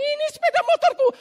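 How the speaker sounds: high, loud, fast outburst; excited cry. Retold or imitated. Friend spotting the lost motorbike, excited high shout. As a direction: cry out in sudden recognition.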